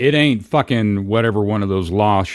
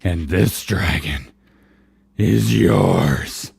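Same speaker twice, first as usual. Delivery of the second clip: rough beast voice; much slower than usual. Acted. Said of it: "Read this in the rough man woman beast voice".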